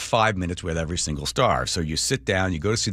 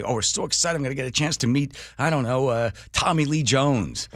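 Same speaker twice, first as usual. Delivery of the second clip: higher-pitched gushing reporter; mock excitement; 6 semitones higher than usual. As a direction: gush like an eager fan about to meet a star. Imitating eager junket reporters.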